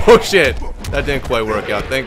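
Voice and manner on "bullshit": loud, frustrated bullshit; angry outburst. Real, not acted. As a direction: snap it out in anger.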